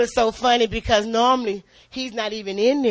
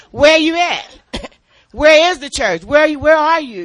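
louder, higher call to the congregation; loud preacher's call to the church; 5 semitones higher, 8 dB louder than usual. Acted. Calling out to the church.

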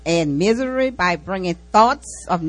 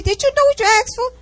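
high, quick and a bit louder; friend's pointed question; 5 dB louder, faster than usual. Retold or imitated. Friend on the phone asks if she knew what she prayed for, high and quick. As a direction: quote her friend with a sharp knowing lift. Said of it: "She said".

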